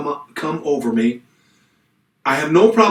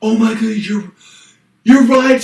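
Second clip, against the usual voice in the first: sudden realization; 7 semitones higher, 5 dB louder than usual. Retold or imitated. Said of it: "And she goes".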